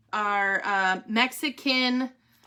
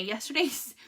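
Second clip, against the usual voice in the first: ordinary talk, nothing marked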